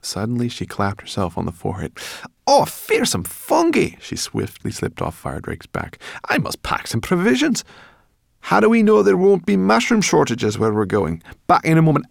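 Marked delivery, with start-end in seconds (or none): 2.5-4.0 very high, loud, drawn-out cry; shrieky little creature outburst; alarmed exclamation. A narrator's reading. Sorrel suddenly remembers, exclaims. 6.3-12.1 high, loud, fretting creature; high-pitched bossy brownie in a panic; flustered Sorrel. A narrator's reading.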